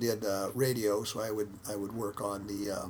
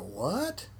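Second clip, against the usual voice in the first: puzzled what; 5 semitones higher than usual. Retold or imitated. Baffled by Chinese instructions.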